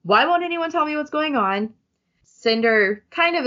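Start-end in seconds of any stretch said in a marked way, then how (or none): 0.0-1.7 higher-pitched, frustrated questioning. A narrator's reading. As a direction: voice a frustrated character demanding answers. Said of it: "Aiko is frustrated".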